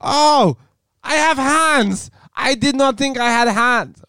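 high, loud hammed-up reply, slow at first; mock Giuliani. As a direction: squeal a dumb excuse. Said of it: "New York".

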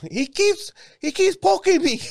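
put-on high falsetto old lady voice; squeaky old lady complaining; man doing a woman's voice. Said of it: "Why does he sound like an old lady?".

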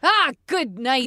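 slow, drawn-out "Ah, good night" as a mock curse; exasperated oath. Shown as a demonstration. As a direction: stretch it out like a weary grumble. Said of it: "Like that?".

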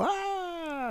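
long, high-pitched imitation yell; imitated child's scream. Worker showing co-host the child's cry, imitated scream. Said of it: "como un grito".

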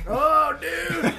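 loud, high, laughing exclamation; thrilled outburst. Real, not acted. Friend hearing a favourite show named, loud and thrilled. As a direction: burst out in delight.

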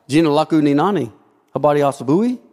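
spoken in Swahili, quick and flowing; Swahili demo. Shown as a demonstration. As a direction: rattle off the Swahili greeting. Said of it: "speak in Swahili".

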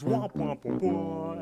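high, loud imitated "womp womp"; mock womp-womp noise. Muscle Man heckles Rigby at the pull-up bar, taunting womps. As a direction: hoot it out like a taunting sound effect. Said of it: "Muscle Man goes".

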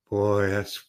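normal talking voice

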